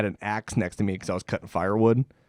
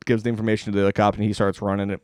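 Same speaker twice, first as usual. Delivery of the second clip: ordinary talk, nothing marked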